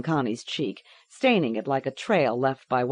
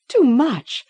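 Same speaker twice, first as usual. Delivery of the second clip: gasping; 11 semitones higher, 7 dB louder than usual. A narrator's reading. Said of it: "gasped Gloria".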